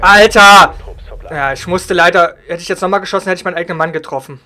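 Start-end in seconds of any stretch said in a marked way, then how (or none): 1.3-4.4 furious yell at a teammate; real frustrated shouting; man down shout. Real, not acted. Teammate blocks the shot, frustrated.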